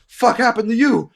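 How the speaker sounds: loud, high, startled demand; shouting lord. Acted. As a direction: bellow it as an alarmed lord. Minor lord confronts wounded boy.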